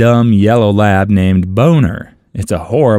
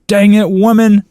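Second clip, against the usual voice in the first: high, punchy imitated yell; mock yelling; 10 semitones higher, 4 dB louder than usual. Father calling his bloodhounds at night, imitated yell. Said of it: "then yelling".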